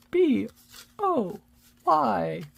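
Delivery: spelled-out cheer chant; cheerleader. Pretend. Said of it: "Sound like a cheerleader".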